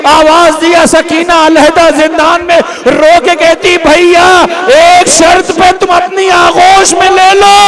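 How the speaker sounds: high, loud shouted lament; full force wailing. Acted. Preacher voicing Sakina in the dungeon, shouted wail. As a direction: cry it out at the peak.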